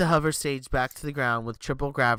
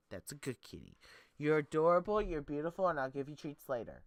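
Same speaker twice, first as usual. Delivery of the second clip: calm the scared kitty down; 7 dB quieter than usual. Real, not acted. Soothing her frightened cat.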